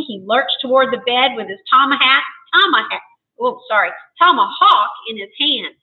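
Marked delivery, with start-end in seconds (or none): none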